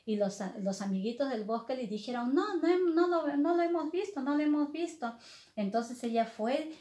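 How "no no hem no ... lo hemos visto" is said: higher-pitched little creature voices; forest animal friends. Acted. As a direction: answer quickly as little woodland friends, pitched up. Animal friends answer Alicia.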